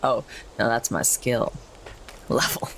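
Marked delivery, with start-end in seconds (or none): none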